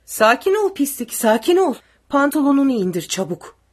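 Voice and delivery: high, loud soldier's orders; shouted commands. A narrator's reading. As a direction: yell him into submission. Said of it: "diye bağırdı uzun olanı".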